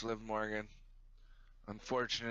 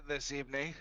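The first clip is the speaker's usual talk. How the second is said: ordinary talk, nothing marked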